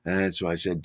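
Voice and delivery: ordinary talk, nothing marked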